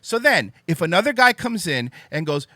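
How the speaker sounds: ordinary talk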